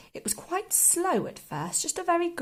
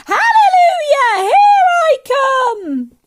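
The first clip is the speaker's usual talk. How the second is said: put-on high screechy old woman's holler; stretched-out, jubilant shriek; triumphant shout; 16 dB louder, slower than usual. A narrator's reading. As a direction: yell it slowly at full force. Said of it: "she shouted, finding her voice at last".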